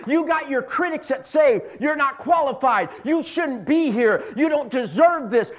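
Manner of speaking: loud, driving sermon voice; full-force preacher; fiery preaching. Acted. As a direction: preach it hard and loud to the church.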